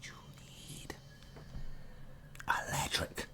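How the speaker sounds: breathy whispered hint. Pretend. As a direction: whisper it like a secret. Sudden whisper while teaching.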